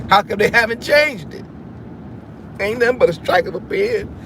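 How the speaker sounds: ordinary delivery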